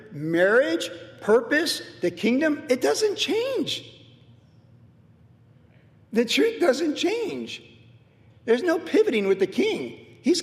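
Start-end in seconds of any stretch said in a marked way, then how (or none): 2.7-7.6 drawn out, high and deliberate; slow, insistent proclamation; slow emphatic. Real, not acted. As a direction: lean on every word.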